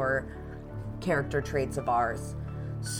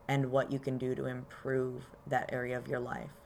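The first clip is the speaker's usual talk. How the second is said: calm grounding exercise; 4 dB quieter than usual. Acted. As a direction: guide them gently into their body. Leads a grounding meditation.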